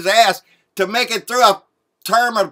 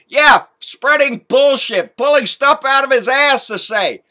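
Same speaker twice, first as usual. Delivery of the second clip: angry rant; 6 semitones higher, 6 dB louder than usual. Real, not acted.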